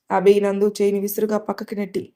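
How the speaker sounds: usual speaking voice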